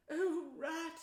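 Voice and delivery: exhausted little friend crying out; trembling cry. A narrator's reading. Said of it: "exhausted and still trembling".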